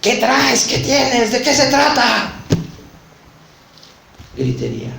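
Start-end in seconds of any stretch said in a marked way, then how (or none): 0.0-2.3 high, loud furious yelling; shouting. Retold or imitated. Man yelling after punching an object, loud and high. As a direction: yell it out in a rage. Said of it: "Empecé a vociferar".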